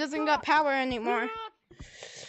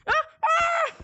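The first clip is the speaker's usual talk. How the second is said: startled scream; 11 semitones higher than usual. Real, not acted. Startled shout in game.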